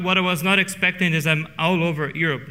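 normal talking voice

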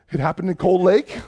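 ordinary talk, nothing marked

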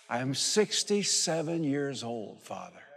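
drawn-out, solemn testimony; slow preaching emphasis. Real, not acted. Preacher testifying his age to the Father, slow and solemn. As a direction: lean on every word like an oath.

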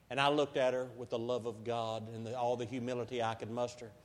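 ordinary talk

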